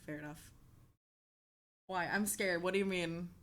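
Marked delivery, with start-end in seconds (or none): 0.1-0.5 dropped to a murmur; quiet fair enough. Acted. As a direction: say it softly under breath. Repeats phrase, each take softer.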